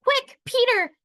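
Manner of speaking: high, loud urgent shout; girl shouting to her brother to hurry; urgent call. Acted.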